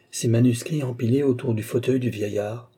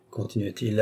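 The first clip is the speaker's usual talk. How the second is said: usual speaking voice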